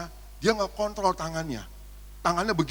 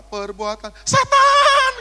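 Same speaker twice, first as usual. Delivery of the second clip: high startled shriek, slowed; shocked cry; 9 dB louder, much slower than usual. Retold or imitated. Worshipper opening eyes to a smeared face, shrieking. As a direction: yelp in mock horror.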